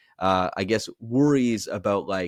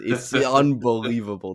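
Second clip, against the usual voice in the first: mock enlightened voice; 4 dB louder than usual. Shown as a demonstration. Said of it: "fake enlightenment thing".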